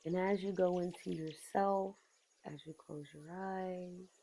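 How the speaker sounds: very soft, unhurried meditation voice; guided meditation. Real, not acted. Asking listeners to close their eyes and go inward, guided meditation. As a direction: ease them inward softly, with long pauses between phrases.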